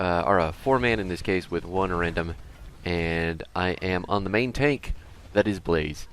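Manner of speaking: ordinary talk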